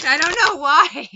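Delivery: loud, high burst after laughing; hyper shriek. Shown as a demonstration. Laughing at her own failed accent, hyper shout. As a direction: shout it, still giddy from the bad accent.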